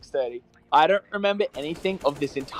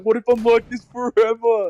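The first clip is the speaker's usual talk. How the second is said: mock freak-out; 6 semitones higher, 4 dB louder than usual. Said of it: "Zuckles was freaking out".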